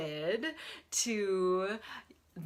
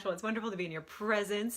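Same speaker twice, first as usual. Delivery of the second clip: ordinary talk, nothing marked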